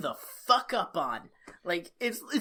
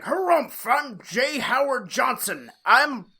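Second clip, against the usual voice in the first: loud, blustering fat-cat caricature; bad rich guy; 4 semitones higher, 7 dB louder, slower than usual. Rich villain introduces himself, hammed-up bad rich guy. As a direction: harrumph it out like a pompous villain. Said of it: "picture a bad rich guy".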